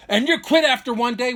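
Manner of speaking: high, loud imitation of an angry brother; mock yell; retold angry yelling. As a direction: throw it out as a reenacted accusation. Continues the brother's screaming tirade.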